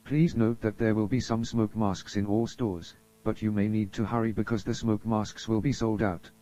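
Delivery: ordinary talk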